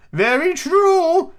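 put-on very high, slow, prim old lady; Duchess voice. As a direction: hold the Duchess's high sing-song. Duchess voiced in read story.

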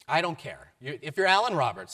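loud, curt and impatient; impatient insistence. Retold or imitated. Shelter worker cutting Alan off, loud and impatient. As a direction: brush him off and insist.

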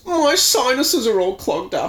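high whiny sob; sobbing. Acted. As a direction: whimper it through tears. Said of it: "he sobs".

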